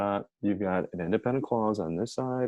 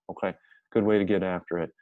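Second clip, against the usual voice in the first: ordinary talk, nothing marked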